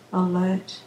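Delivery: ordinary delivery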